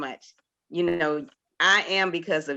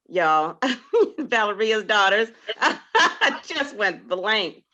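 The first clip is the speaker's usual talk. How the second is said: flustered laughing; 6 semitones higher, 5 dB louder than usual. Real, not acted. Laughs, forgets names, flustered.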